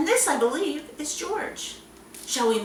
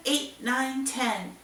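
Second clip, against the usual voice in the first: normal talking voice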